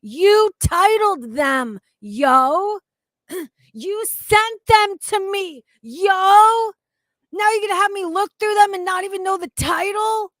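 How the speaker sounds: high shouting outrage, dragging each word; furious shout. Real, not acted. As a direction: shout at the coworker who made you search. Angry rant addressed to coworker.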